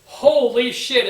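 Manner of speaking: exasperated. Real, not acted. Exasperated at the feud.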